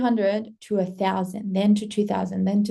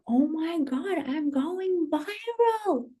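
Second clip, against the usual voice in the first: high, slow, drawn-out gushing; excited squeal; 8 semitones higher, 4 dB quieter, slower than usual. Retold or imitated. Creator re-enacting her shock at going viral, excited squeal. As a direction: stretch VIRAL out long and high. Repeated reaction, heightened.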